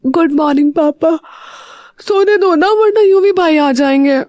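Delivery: half-asleep daughter begging to sleep on; sleepy. A narrator's reading. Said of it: "Meera नींद में कहती है". (नींद में, ऊँची उनींदी आवाज़)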